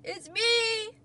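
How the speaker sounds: high, loud calling voice; Toad's shout. A narrator's reading. Toad tries to reach Frog across the river, shouting. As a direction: holler to be heard far off. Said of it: "he shouted".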